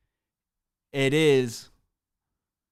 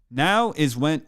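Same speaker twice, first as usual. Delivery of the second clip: reading voice; 4 semitones higher, 8 dB louder, much slower than usual. Retold or imitated. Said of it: "let me get my voice on".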